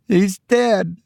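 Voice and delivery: high, steady woman's voice. A narrator's reading. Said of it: "She said it steadily".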